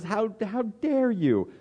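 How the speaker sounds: slow, high, offended "how dare you"; defensive indignation. Retold or imitated. Pastor voicing his defensive inner thought to the congregation, slow and high with mock offence. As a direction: take mock offence, drawn out. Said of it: "everything in me gets defensive and says".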